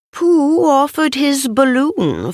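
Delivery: plain reading voice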